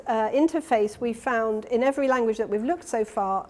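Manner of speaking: ordinary delivery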